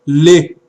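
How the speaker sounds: "Le" punched out short and loud; pronounced sheva. Shown as a demonstration. Teacher showing that the sheva is pronounced, a loud isolated "Le". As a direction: give "Le" a clear, voiced short vowel. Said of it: "está sendo pronunciado".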